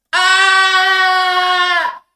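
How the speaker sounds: piercing high, loud shriek of pain; high scream. Retold or imitated. The lemon cut open, a piercing scream. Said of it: "opening up that apple would scream".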